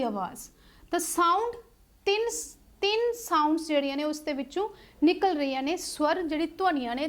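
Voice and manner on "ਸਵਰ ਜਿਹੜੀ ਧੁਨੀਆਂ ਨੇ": usual speaking voice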